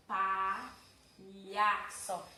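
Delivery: palhaço stretched very slowly, syllable by syllable; very slow demo. Shown as a demonstration. Teacher to class, sounding palhaço out slowly. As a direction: draw out palhaço to show the lh. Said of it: "aqui eu tenho palhaço".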